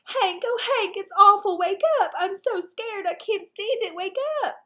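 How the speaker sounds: high, loud panicked squeal; high-pitched squeal. Acted. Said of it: "I heard a high-pitched squeal".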